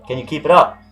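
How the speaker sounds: normal talking voice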